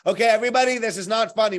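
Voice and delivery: mock-stern announcement to everybody; exasperated call-out. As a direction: call everyone to order, half-joking. Calling out to everyone.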